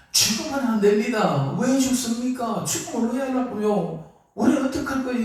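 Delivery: high, urgent plea to his master; Peter pleading. Acted. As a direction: beg him not to die, pitched well up. Peter pleads Jesus not die. (스승에게 죽지 말라고 높은 목소리로 애원하는 베드로)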